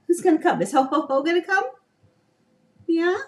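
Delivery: playful grandma singsong. Real, not acted. Coaxing toddler on camera.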